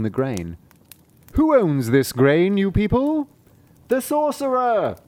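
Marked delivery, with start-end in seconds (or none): 1.3-3.3 louder, slow, rising high by the end; the cat demanding answers; a slow cat calling to the reapers; cat's voice. Acted. 3.9-5.0 very high, slow reply; high, slow workers' answer; reapers' voice. Acted.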